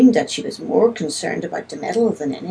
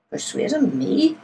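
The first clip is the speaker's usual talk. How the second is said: amused disbelief, pitched high with a small laugh after; 6 semitones higher than usual. A narrator's reading. Man denying he could persuade the youngster, laughing. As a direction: laugh off the idea in a high questioning voice. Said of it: "I laughed".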